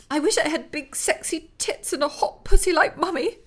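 high, cracking blurt. Acted. Daughter blurting to her father, high and cracking. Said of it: "she blurted suddenly, her voice cracking".

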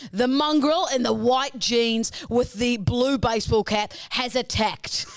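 slow, dramatic cop show voiceover; TV narrator voice. Retold or imitated. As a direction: stretch it out like a reality TV narrator. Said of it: "he's like".

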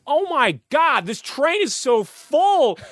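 high, drawn-out American whine; American accent. Retold or imitated. American family coming upstairs on a packed train, exaggerated American accent. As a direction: put on a high-pitched complaining American voice. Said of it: "the American family's walking up the stairs going".

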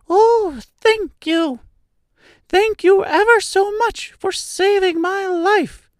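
tiny, very high-pitched squeak; squeaky little mouse. A narrator's reading. Field mouse thanks the Woodman who saved it, squeaky little voice. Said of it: "in a squeaky little voice".

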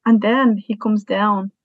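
usual speaking voice

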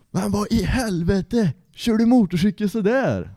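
a nurse staring in disbelief at the patient; shocked nurse. Retold or imitated. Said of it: "Jag bara Åh MC-olycka".

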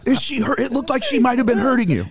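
very high, mock-worried voice; hammed-up worried bystander; mock concern. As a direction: check on her in a silly high pitch. Acted alarmed check on couple.

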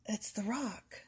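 breathy whispered aside. Pretend. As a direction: hush it like a secret. Whispered aside about The Rock.

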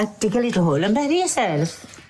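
light Welsh teacher voice; a hint of a Welsh teacher; Welsh accent. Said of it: "I said that in Welsh accent".

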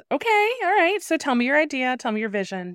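high-pitched indulgent mom voice; acted-out patient parent; humoring mom. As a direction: go up high, playing along with a child's big plan. Mom humoring daughter's hair request.

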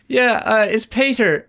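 high, loud imitation of an Irish caller; Irish caller, hammed up; Irish accent. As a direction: play the Irishman on the phone, pitched right up. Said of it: "Hilarious Irish accent".

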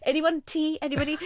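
high chirpy tea call; offering tea. Retold or imitated. [name] mocking her own tea offers, high and chirpy. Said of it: "So I was like".

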